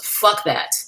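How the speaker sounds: ordinary delivery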